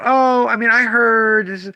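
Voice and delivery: high, slow, dismissive mimic; mocking customer. Owner imitating customers who buy from hype, slow mock. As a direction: lightly parody a hype-following buyer.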